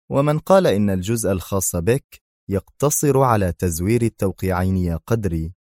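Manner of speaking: plain reading voice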